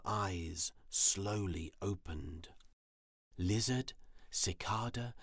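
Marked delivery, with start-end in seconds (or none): none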